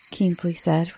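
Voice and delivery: usual speaking voice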